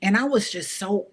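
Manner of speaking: ordinary delivery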